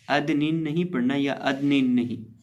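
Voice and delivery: ordinary delivery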